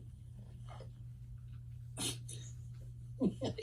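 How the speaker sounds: breaks into high, breathless laughter; can't hold back a laugh; laughing at own joke. Real, not acted. Cracking up after answering a fan's question, laughing. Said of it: "Even I can't keep a straight face".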